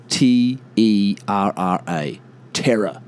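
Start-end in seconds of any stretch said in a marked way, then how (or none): 2.5-3.0 "Terror" with short e, for Tara; American pronunciation. Shown as a demonstration. Spelling out the American version, American pronunciation. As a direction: say "Terror" as the name. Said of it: "They say it".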